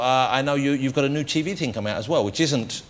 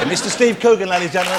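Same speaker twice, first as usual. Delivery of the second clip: announcer voice; 5 semitones higher, 5 dB louder than usual. Acted. Announcing the guest.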